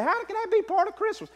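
high, puzzled voice of a skeptic; mock doubting listener. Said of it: "You say".

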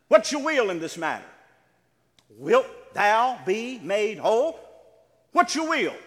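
loud, emphatic preacher's demand; forceful challenge. Acted. Preacher voices Jesus' challenge.

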